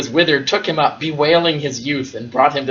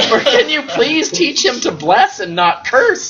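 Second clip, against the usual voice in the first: much higher, squeaky mock-outraged parent through laughter; hammed-up indignant villager giggling; laughing outrage; 12 semitones higher than usual. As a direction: play the parents' complaint but keep cracking up. Bereaved parents blame Joseph.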